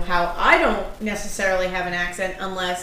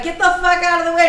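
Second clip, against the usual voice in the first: retold yell; 8 semitones higher, 4 dB louder than usual. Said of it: "he's like".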